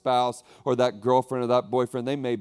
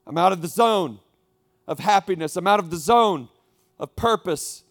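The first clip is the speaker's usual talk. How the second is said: louder, pressing out of the zone; louder lament; 5 semitones higher, 8 dB louder than usual. Acted. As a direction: push the loss out loud. Preacher voicing a lost listener.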